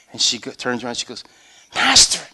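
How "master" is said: higher, sudden exclamation; startled recognition. Retold or imitated. Mary turns to Jesus at the tomb, startled recognition.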